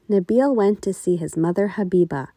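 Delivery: ordinary delivery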